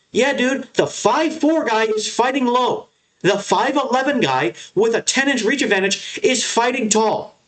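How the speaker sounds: high, slow sarcastic mimic of a clueless pundit; mocking dumb commentator. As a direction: parrot the obvious call, dripping sarcasm. Mocking commentators' obvious remarks.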